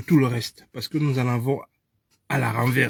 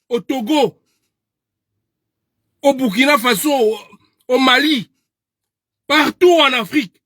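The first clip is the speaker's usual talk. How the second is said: building passion; 7 semitones higher, 3 dB louder than usual. Real, not acted. Listing countries, rising higher.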